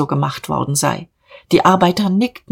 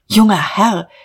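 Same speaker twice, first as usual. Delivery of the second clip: urgent, higher-pitched appeal; agitated address; 4 semitones higher, 4 dB louder than usual. A narrator's reading. Bookkeeper runs after young boss, agitated call. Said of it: "er war in großer Aufregung".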